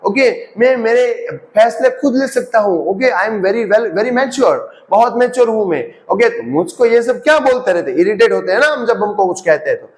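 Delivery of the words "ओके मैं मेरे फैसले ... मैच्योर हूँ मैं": put-on higher, slow self-important child; proud kid. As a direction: act the kid who thinks he's mature. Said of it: "मेरे को लगता है". (खुद को बड़ा समझते बच्चे की ऊँची आवाज़)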